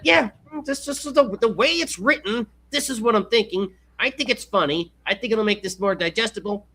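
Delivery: nerd voice. Retold or imitated. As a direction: play the nerdy writer. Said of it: "this person sounds like a nerd".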